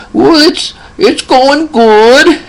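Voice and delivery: put-on very high, drawn-out old man voice; little old man. Old patient answering his nurse's small talk, little old man voice. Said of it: "he talks like this".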